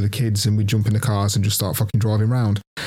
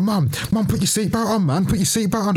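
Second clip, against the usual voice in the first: put-on high, whiny kid begging with a laugh; pleading child; 9 semitones higher than usual. Scared boy begging his mum to buckle up, high and whiny. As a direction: nag like a scared little kid.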